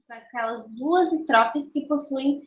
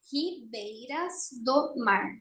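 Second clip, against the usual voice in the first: "Ribeiras do mar" slowly, syllable by syllable, softer; escansão silábica; 7 dB quieter, slower than usual. Shown as a demonstration. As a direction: scan "Ribeiras do mar" with each syllable separated. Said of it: "vamos fazer a escansão". ("Ribeiras do mar" devagar, sílaba por sílaba)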